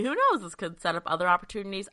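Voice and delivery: ordinary delivery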